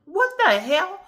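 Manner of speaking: high, loud startled yelp; shocked. Retold or imitated. Shoulder suddenly stuck, she blurts out a shocked high yelp. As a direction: leap up in pitch, caught off guard. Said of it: "I'm like".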